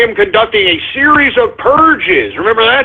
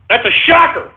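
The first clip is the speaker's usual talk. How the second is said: mock shock; 8 semitones higher than usual. Real, not acted. Host reacts to dictator's death news, high mock shock. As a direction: play up the shock, pitched up. Repeated higher.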